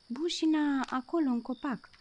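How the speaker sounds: high, loud urgent pointing-out; insist, pointing at the tree in alarm; alarmed kitten. Acted. Kitten insisting to the cricket, high and urgent.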